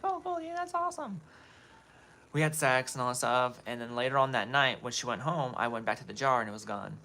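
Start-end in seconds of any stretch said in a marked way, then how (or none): 0.0-1.2 high, put-on voice of the girlfriend faking interest; mock girlfriend voice. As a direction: play the sneaky girlfriend in a high false voice. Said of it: "she's like".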